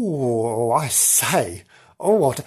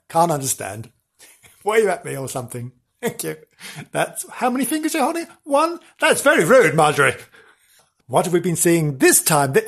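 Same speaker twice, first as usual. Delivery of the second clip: normal talking voice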